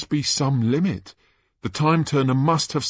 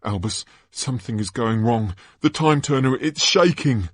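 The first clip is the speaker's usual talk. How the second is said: higher, anxious voice; mild alarm, trying to get his attention; worried warning; 6 semitones higher than usual. Acted. Boy warning his friend the time turner is failing, anxious. Scorpius warns of danger.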